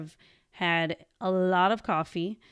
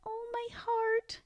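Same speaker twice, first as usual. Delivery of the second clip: much higher, slow, soft swoon; 6 dB quieter, slower than usual. Retold or imitated. Hearing boyfriend say I love you, a slow soft swoon. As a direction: melt as if your heart just burst.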